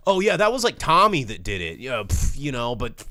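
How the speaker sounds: put-on careless teens. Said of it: "instead they were like".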